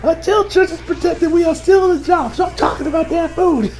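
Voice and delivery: shouted quote. Retold or imitated. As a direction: yell it at them, fed up. Quote ends the story's argument.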